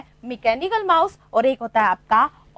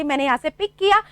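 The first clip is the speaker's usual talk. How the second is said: usual speaking voice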